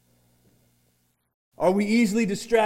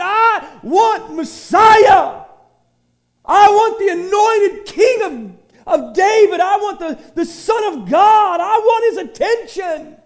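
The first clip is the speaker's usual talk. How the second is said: loud, slow, higher shout of longing; blind man's desperate cry; 10 semitones higher, 7 dB louder, slower than usual. Retold or imitated. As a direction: shout it like a beggar begging for the Messiah. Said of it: "He said".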